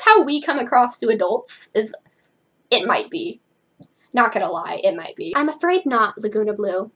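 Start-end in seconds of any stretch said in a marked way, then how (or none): none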